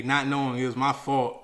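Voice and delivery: normal talking voice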